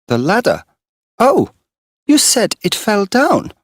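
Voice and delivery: high, loud sudden discovery; catching him out in a lie; surprised girl. Acted. Girl to Morris, surprised and loud.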